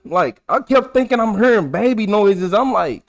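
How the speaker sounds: ordinary talk, nothing marked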